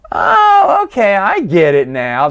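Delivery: hammed-up dawning understanding; sudden realisation. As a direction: swoop in high as if it just clicked. Acts out reader's sudden realisation.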